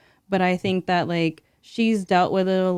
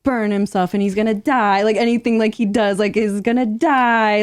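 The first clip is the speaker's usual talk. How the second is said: louder, higher worst-case worrying; mock panic; 3 semitones higher, 6 dB louder than usual. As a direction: spiral into exaggerated motherly alarm. Voicing her irrational motherly worries.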